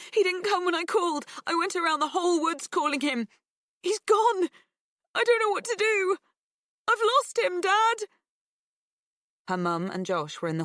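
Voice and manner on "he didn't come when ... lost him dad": high, loud, upset pleading; distraught. A narrator's reading. Tearful girl telling her dad she lost the dog, high and upset. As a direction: near tears, pleading.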